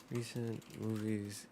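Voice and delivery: very soft, slow mumble; muttering to oneself. Real, not acted. Speaker searching for a forgotten movie, muttering the words. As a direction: murmur each search word slowly to yourself.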